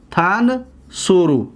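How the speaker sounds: TAN, then SURU, slowly split with a pause in تنصرو; syllable by syllable. Shown as a demonstration. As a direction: break تنصرو into slow, separate pieces for learners. Teacher breaks word into syllables. (تنصرو را آهسته و هجا به هجا)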